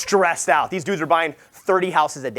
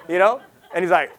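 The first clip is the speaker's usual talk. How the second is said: ordinary talk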